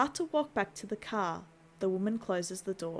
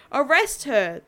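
repeated loud command shout; yelling; 5 semitones higher, 10 dB louder, slower than usual. Acted. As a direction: shout the order again, full force. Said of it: "the officer yells out".

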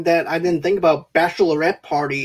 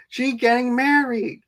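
imitated movie character; 6 semitones higher than usual. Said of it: "he goes".

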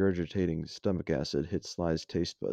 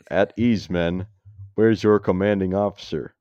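loud military command; commanding general; 2 semitones higher, 8 dB louder than usual. Acted. General greeting saluting soldiers, loud and commanding. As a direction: project it like an officer addressing troops.

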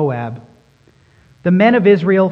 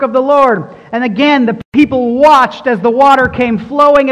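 rising, louder sermon build; preaching at full force; 5 semitones higher, 6 dB louder than usual. Acted. Preacher driving home God's faithfulness, mounting louder and higher. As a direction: preach it harder and harder, climbing.

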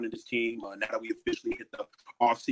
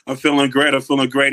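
excited; 2 semitones higher, 9 dB louder than usual. Real, not acted. Guest greets host loudly.

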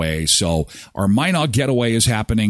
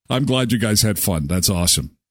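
normal talking voice

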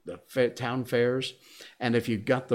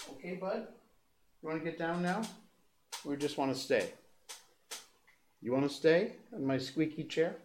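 high, soft coaxing; soft doting dog talk; 4 semitones higher, 9 dB quieter than usual. Real, not acted. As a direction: gently coax the dog down.

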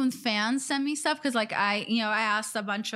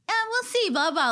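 put-on chill girl; 9 semitones higher than usual. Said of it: "like a chill girl".

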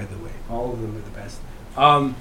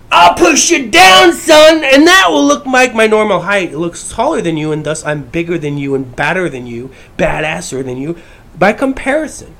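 mock bully shout; 5 dB louder than usual. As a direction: bark it down at the victim like a big bully. Voicing a bully pushing you down.

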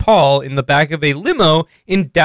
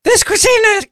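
put-on much higher dopey man-child voice; Ralph voice. First try at Ralph, answering the dad in a high dopey voice. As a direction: try out a goofy slacker whine. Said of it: "I gotta practice my, my Ralph voice".

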